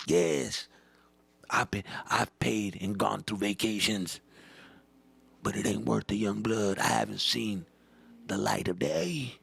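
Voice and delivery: low, slow veteran voice; weary old employee's lament; bitter old-timer. Acted. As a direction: draw out each regret like a worn-out lifer. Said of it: "that bitter employee".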